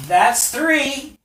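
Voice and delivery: high, loud final count; Lanny counting down. Acted. Lanny ends the countdown before attacking, high and loud. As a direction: snap the last number out, high and loud. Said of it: "And you hear Lanny say".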